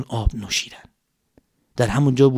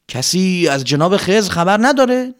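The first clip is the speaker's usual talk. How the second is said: calling out; 5 semitones higher, 4 dB louder than usual. Acted. Calling out slowly in cave.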